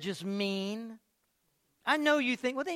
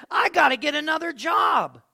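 loud, high mock complaint; exasperated outcry; 9 semitones higher, 12 dB louder than usual. Preacher mimics people regretting answered job prayers, loud exasperated outcry. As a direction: cry it out like someone desperate to quit.

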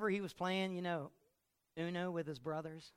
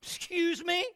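high indignant squeak; wife taken aback by husband; offended wife; 14 semitones higher, 9 dB louder, slower than usual. Acted. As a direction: pitch up in mock outrage. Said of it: "she's like".

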